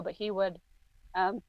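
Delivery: soft, trailing off as tears threaten; holding back tears. Real, not acted. Telling of grandfather's goodbye, trying not to cry. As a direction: fight back real tears, quietly. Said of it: "I'm trying not to cry now".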